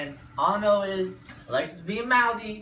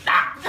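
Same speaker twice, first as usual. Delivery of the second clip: short yell; 6 dB louder than usual. Retold or imitated. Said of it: "she was like".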